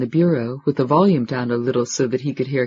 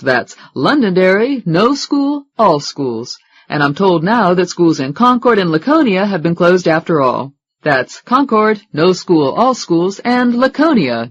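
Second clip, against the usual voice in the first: brisk, bright DJ read, a bit louder; radio announcer; 3 semitones higher, 6 dB louder than usual. Acted. Radio host reading school closings on air, upbeat announcer.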